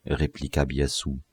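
ordinary delivery

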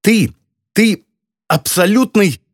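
high, halting, indignant sputter; lost for words. A narrator's reading. Girl failing to find insults for him, exasperated. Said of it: "не сумев подобрать подходящих слов".

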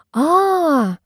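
high, rounded "aah"; high, long sound of understanding; drawn-out "aah" of realisation. A narrator's reading. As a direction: stretch an "aah" as it dawns on you. Friend nods, suddenly understanding.

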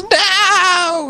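very high cry of "No!" with a laugh; mock terrified no. Imitating the chef under garbage, mock terror. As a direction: wail "No!" as a hammed-up victim. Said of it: "And he goes".

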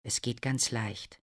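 ordinary delivery

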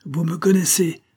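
high, exasperated cry. A narrator's reading. Stranger exasperated by the major's silence, crying out. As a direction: pitch it high in accusation. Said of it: "s'écria-t-il".

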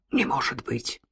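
low, soft murmur; quiet, low astonishment; hushed disbelief. A narrator's reading. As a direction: drop low and barely voice it. Character's hushed disbelief at the news.

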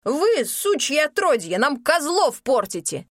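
high, loud, slow cursing; furious slow yell. Acted. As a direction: spit the curse out slowly. Innkeeper accuses and curses.

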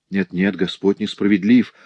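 usual speaking voice

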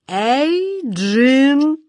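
high cracked call that squeaks; a shout that cracks into a squeal; voice breaking into a squeal. A narrator's reading. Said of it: "крикнул король, но голос его сорвался на жалкий визг".

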